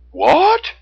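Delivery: high, loud, baffled "what"; confused outcry. A narrator's reading. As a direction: blurt it out in high-pitched confusion. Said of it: "confused by her answer".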